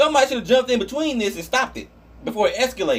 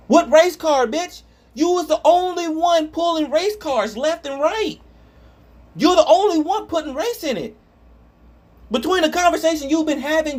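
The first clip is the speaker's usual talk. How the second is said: heated rant; 6 semitones higher than usual. Real, not acted. Ranting at the commenter.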